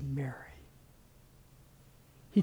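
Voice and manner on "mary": low, soft calling of a name; gentle. Retold or imitated. Jesus calls the grieving Mary by name, gently. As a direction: say the name tenderly and quietly. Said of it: "he just gently says".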